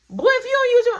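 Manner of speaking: put-on high, loud warning to the boy; mom scolding. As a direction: mom scolding her son to answer properly, high and loud. Mom scolding her son.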